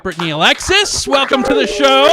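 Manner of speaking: rising, loud show-host introduction; announcer shout. Real, not acted. Host welcomes a new comic to the show, announcer shout. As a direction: belt out a welcome for the act.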